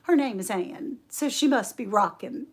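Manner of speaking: ordinary delivery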